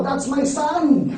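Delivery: proud shout. Retold or imitated. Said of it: "may sumisigaw".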